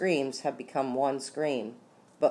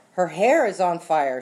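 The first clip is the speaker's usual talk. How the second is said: loud, raised-pitch call; alarmed answer; 4 semitones higher, 6 dB louder than usual. A narrator's reading. Onlooker answers about Rita's hair, loud alarmed call. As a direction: call the alarm across a room. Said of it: "someone answered".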